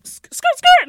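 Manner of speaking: high screeching skirt of tyres. Retold or imitated. Boyfriend's car screeching away, imitated high and loud. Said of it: "just went".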